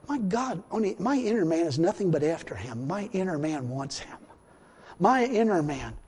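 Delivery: hushed realization. Retold or imitated. Believer looks inside and finds longing, hushed realization. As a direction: drop to a quiet, private wonder. Retelling his thought on looking inside.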